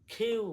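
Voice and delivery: "খিউ" with a puff on kh; aspirated Q. Shown as a demonstration. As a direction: start "খিউ" on breathy kh, glide to yoo. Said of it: "কি পড়বো?". ("খিউ" বাতাস দিয়ে)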